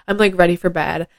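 ordinary talk

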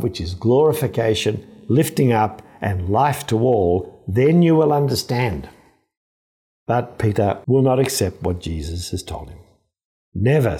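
ordinary talk, nothing marked

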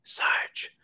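unvoiced soft sarge; quiet sarge. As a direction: breathe it out quietly. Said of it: "And he just goes".

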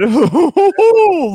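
high, loud villain giggle; creepy giggle. Pretend. Inviting everyone to his cabin, high creepy giggle. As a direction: burst into a sinister giggle.

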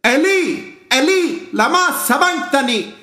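crying out high and loud in anguish. Acted. Jesus crying out to God from the cross, loud anguished cry. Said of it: "Or let me say it like this".

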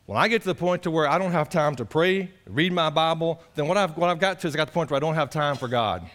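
usual speaking voice

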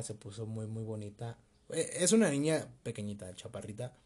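ordinary talk, nothing marked

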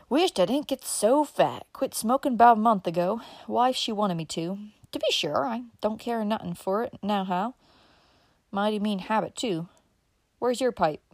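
chatty rural uncle, puffing after sitting; old country uncle. Acted. Uncle Bobby chatting to Dick in the print shop, folksy old man.

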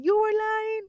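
high, loud incredulous question; retold disbelief. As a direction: re-enact the shock of finding out, pitch shooting up. Retelling her shock at his confession.

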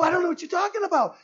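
high, loud and fast; high mock confusion. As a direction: rattle it off, playing confused. Pastor playing dumb.

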